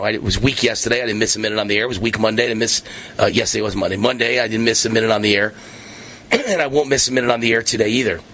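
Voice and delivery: ordinary talk, nothing marked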